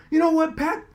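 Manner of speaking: mock yell back, pitched high; retold yelling. Reporter yelling back at the coach, mock yell. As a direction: fire it back at him. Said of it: "We're screaming at each other".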